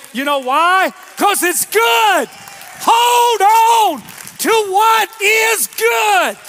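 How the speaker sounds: very high, very loud, stretched-out sermon climax; roaring preacher at peak intensity; full-force preaching shout. Real, not acted. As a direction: shout it to the whole congregation, holding the words long.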